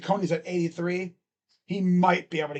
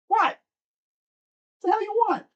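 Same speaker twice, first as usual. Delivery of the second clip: high voice for the cat. Real, not acted. Streamer grilling his cat who wandered in, much higher. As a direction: squeak it up like chatting to a cat.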